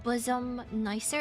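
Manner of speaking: ordinary delivery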